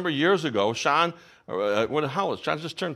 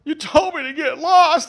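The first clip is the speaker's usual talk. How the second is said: put-on high, loud, slow sobbing child voice; imitating crying child; 13 semitones higher, 6 dB louder, slower than usual. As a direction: whimper it like a hurt little boy. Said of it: "He's crying".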